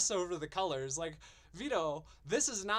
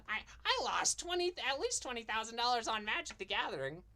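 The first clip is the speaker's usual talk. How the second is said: mocking Vito; 5 semitones higher than usual. Mocking Vito's confession.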